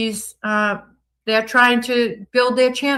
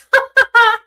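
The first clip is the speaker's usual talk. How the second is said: high loud burst of a laugh; real laugh bursting out; burst of laughter; 7 dB louder than usual. Real, not acted. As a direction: crack up high at the joke. Laughing over sparklers chat.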